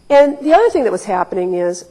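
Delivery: usual speaking voice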